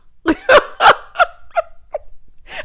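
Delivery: loud, high peals of laughter; real squealing belly laugh; high cackle. Real, not acted. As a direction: burst out laughing at yourself. Laughing at her own question.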